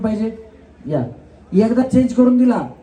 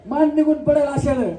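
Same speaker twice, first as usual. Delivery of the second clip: ordinary talk, nothing marked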